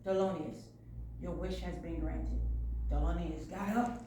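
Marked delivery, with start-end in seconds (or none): 0.1-2.4 low, quiet voice granting a wish; soft wish-granting. Acted. Princess Fish to Thelonious, granting his wish, soft and low. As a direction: hush it down low.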